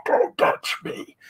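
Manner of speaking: slow breathy masked voice; hazmat-suited fan warning people off through a mask; Darth Vader. Retold or imitated. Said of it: "we were like Darth Vader".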